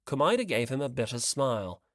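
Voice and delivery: ordinary delivery, nothing marked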